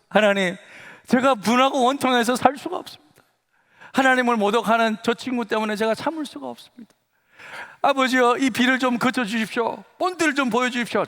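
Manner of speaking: high, impassioned re-enacted prayer; indignant, anguished plea. Wronged student praying out of the window, anguished pleading. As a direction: cry out to God in pent-up hurt. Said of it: "가슴에 막 울분이 생기는".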